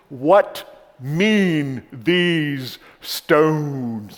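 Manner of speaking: put-on booming, drawn-out heavy British accent; very slow, loud British preacher, each word stretched; Martin Lloyd-Jones. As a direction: intone it powerfully in a thick British accent. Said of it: "say it in his heavy accent".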